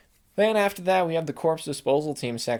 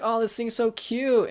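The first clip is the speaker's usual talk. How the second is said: much higher, slow, gushing coo; cooing over baby; 9 semitones higher, 3 dB louder, slower than usual. Retold or imitated. Vlogger telling his wife the BB is cute, high gushing coo. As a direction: melt over something adorable.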